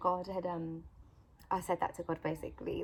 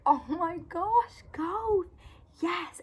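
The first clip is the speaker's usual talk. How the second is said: excited; 11 semitones higher, 5 dB louder, much slower than usual. Retold or imitated. Mum sees golf online.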